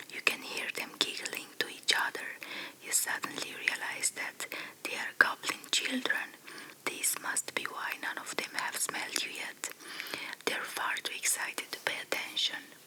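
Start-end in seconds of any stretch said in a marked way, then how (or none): none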